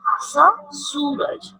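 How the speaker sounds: स drawn out very slowly; sound demo. Shown as a demonstration. Teacher shows children the स sound. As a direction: stretch the स of सूरज. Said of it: "किसकी आवाज है".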